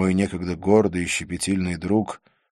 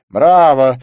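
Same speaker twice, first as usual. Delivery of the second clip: loud, raised cheer; Didier's cry of bravo; 6 semitones higher, 13 dB louder than usual. A narrator's reading. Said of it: "вскричал Дидье".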